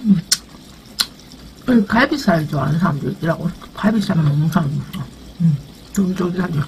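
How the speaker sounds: chewing between words; talking around food; mouth partly full. Real, not acted. Seen 5: Bites food before; cheeks bulge, jaw working while speaking.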